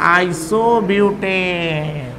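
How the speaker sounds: slow, syllable by syllable; drawn out. Shown as a demonstration. Teacher slowly spelling out the compound name for the class.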